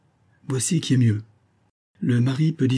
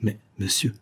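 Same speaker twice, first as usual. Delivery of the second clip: ordinary delivery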